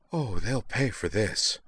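muted, brooding vow; vengeful inner thought. A narrator's reading. Liza fuming to herself after Sky leaves, vengeful. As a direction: seethe privately, held in. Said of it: "she thought".

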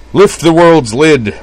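slow, high, loud proclamation; booming rallying cry; grand declaration. A narrator's reading. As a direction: declare it grandly, drawing out each word. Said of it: "Sayonji declared grandly".

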